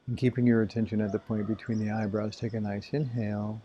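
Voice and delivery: calm, even voice with long silences; spacious, soothing meditation delivery; guided meditation. Acted. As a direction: guide their awareness through the body, leaving space. Leads body-awareness meditation.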